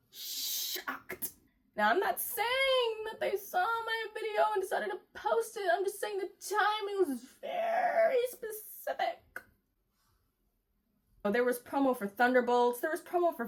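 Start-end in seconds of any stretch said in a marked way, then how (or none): 0.1-1.3 whispered, almost voiceless; breathy whisper. Acted. Whispered punchline. 1.8-9.4 mock innocent. Sly mock-innocent aside.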